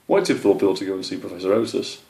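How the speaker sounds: usual speaking voice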